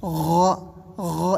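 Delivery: غ gargled low, opening to a rounded vowel; low throaty غ. Shown as a demonstration. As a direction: rasp غ from the throat, then open it. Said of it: "اس طرح".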